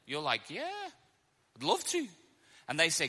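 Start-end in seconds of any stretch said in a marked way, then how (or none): 0.5-2.1 high, soft put-on politeness; polite acceptance. Acted reply to dinner invitation.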